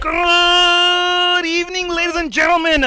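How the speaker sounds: booming drawn-out ring announcer; lucha libre announcer. Acted. Stadium announcer opening.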